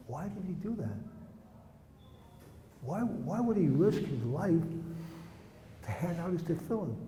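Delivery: very soft, slowing question; hushed wonder. Real, not acted. Asking listeners about the boy's risk, hushed wonder. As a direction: drop right down and let the question weigh. Teller ponders the boy's sacrifice.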